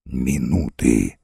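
very low, slow menacing voice; deep and slow, like a verdict; sinister stranger. A narrator's reading. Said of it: "отвечал тот".

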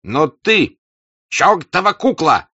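furious high shout. Acted. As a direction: roar at the servant to search. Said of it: "вдруг закричал Денисов".

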